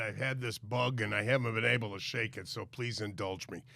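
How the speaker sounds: blocked nose from a cold; talking through a head cold; congested. Real, not acted. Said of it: "I'm congested".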